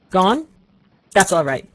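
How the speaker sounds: put-on old father gruffly checks his son has left; gruff and angry. A narrator's reading. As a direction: snap it out, stern and cross. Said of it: "looking angrily".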